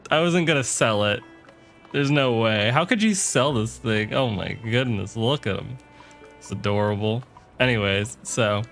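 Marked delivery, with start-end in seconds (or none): none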